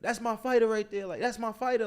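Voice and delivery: imitating him yelling proudly across the street; retold excited yelling. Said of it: "he was, like, screaming".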